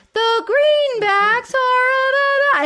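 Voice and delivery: very high, slow, drawn-out falsetto; weird falsetto. Retold or imitated. Host imitating an accountant who can't do accents, weird falsetto. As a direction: imitate a failed Southern accent by just raising the pitch. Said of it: "speaking in this weird falsetto".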